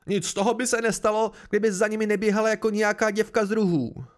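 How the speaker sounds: high, loud angry outburst; vzteklý výbuch. A narrator's reading. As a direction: blurt it out in uncontrolled anger. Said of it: "se neudržel vzteky". (vztekle, zvýšeným hlasem)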